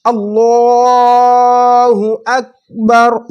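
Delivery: imam's drawn-out call; imam's takbir. Shown as a demonstration. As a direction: call it out like the imam leading prayer. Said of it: "इस तरह से".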